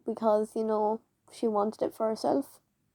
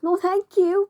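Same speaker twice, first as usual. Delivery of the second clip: high refusal; 8 semitones higher, 6 dB louder than usual. Retold or imitated. Refusing teacher's plan.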